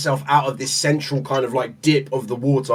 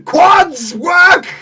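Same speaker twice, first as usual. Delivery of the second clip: high, loud straining yell; straining effort shout; 13 semitones higher, 6 dB louder, much slower than usual. Retold or imitated. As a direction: push every muscle, yelling. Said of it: "you're like".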